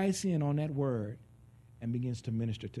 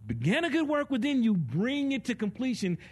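impassioned preaching; 8 semitones higher, 4 dB louder than usual. Acted. Preaching at full force.

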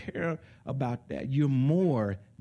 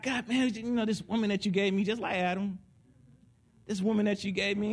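higher, whiny complaint to God; mock whining prayer; 8 semitones higher than usual. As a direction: whine to God about the wife. Complaining to God about his wife.

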